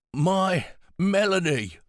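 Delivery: savouring her name, slowly; frail old man, stretched out. A narrator's reading.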